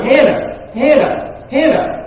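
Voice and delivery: rising call. Acted. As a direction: call out Hannah, climbing in pitch. Calling Hannah, rising each time.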